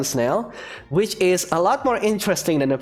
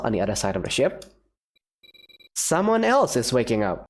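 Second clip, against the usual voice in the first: normal talking voice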